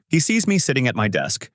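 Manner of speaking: usual speaking voice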